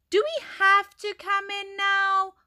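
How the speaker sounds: put-on high-pitched, loud kid's grumble; grumbling. A narrator's reading. Dylan to teacher as playtime ends, grumbling. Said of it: "grumbled Dylan".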